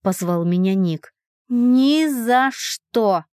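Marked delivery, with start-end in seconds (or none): 1.5-3.2 higher, slowly drawn-out refusal; emphatic refusal. A narrator's reading. Heroine refusing Nick outright, emphatic. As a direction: dig your heels in, stretching each word. Refusing Nick's teasing invitation.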